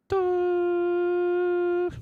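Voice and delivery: long high loud toot; mock toot. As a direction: blast a silly horn sound. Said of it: "Sorry, shouldn't have done that. That was terrible".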